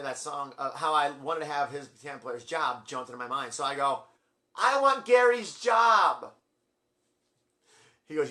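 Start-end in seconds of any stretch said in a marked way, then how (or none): 4.6-6.3 slow, high, very loud holler; bold shouted demand. Retold or imitated. Fan shouts to singer for pianist's job, drawn-out yell. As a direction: holler each word out to the stage.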